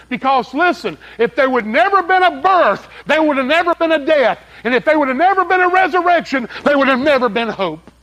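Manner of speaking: high, loud sermon voice hammering never; climactic preaching. Acted. Preacher driving home hope to the congregation, climactic preaching. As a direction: build it to a pulpit climax.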